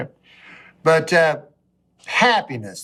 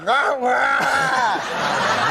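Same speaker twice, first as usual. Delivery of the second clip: mumbled slurred imitation, laughing; a mild mock slur through laughter; imitating Larry Flint's speech; 5 semitones higher than usual. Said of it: "he said to me".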